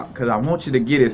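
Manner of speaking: usual speaking voice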